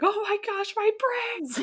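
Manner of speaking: put-on very high squeaky child panic; hammed-up tiny voice; mock kid panic. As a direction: shriek it like a terrified little boy. Mother voices son's panic.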